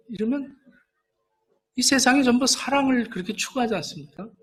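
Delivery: ordinary delivery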